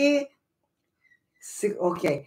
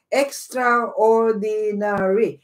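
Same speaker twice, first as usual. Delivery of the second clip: syllable by syllable; much slower than usual. Shown as a demonstration. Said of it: "six syllables".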